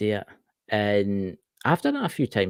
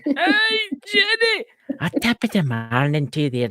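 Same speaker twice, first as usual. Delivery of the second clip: a hint of a hammed-up Irish brogue, high greeting; playful mock Irish accent; 12 semitones higher, 4 dB louder than usual. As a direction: greet Jenny like a cheery stage Irishman. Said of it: "a wee bit of the Irish".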